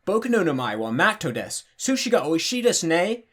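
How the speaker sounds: exaggerated foreign-accented Japanese with swooping pitch; mock beginner's Japanese, pitch lurching up and down; clumsy learner Japanese. Showing how untrained learners end up talking, clumsy foreign accent in Japanese. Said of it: "would otherwise end up talking like this".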